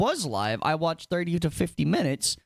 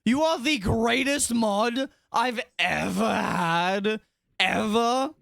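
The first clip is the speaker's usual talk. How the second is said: slow, higher, drawn-out sincere praise; utmost genuine; 5 semitones higher, slower than usual. Real, not acted. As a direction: lay the compliment on slowly and earnestly. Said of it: "I'm just being the utmost genuine right now".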